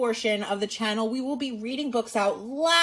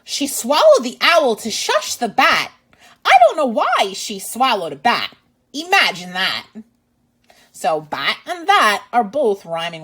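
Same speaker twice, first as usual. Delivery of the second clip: lively, louder read-aloud voice; reading to children; 3 semitones higher, 6 dB louder than usual. Acted. Teacher reading a picture book to kids, lively and loud. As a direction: read it out big for little listeners.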